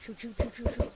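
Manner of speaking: panicked stammering; frantic, scared repeats; shaky stammering panic. Real, not acted. Panicking while being shot at.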